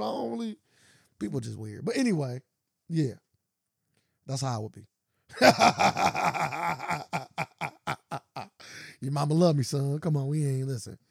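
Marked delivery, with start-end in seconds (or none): 5.3-9.0 real cackle tapering off; long laugh. Real, not acted. Comic tickled by own remark, long fading laugh. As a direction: laugh in repeated bursts, then fade.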